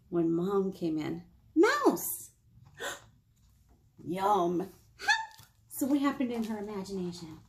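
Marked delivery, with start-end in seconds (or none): 1.5-2.3 high loud mom call. Acted. Mom calling daughter while petting cat, high call. 4.0-4.6 low greedy cat voice; the cat licking its lips; hungry cat. Acted. Cat seeing the mouse girl, low greedy. 4.6-5.5 very high squeaky cry for help; tiny mouse cry. Acted. Mouse girl fleeing the cat, very high squeal.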